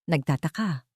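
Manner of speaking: usual speaking voice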